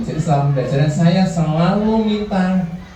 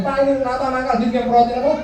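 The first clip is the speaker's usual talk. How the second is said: higher, fussy parent's complaint; complaining parent; 10 semitones higher than usual. Retold or imitated. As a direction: mimic the parent reporting her child. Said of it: "orang ucapnya". (meniru orang tua yang mengeluh, nada lebih tinggi)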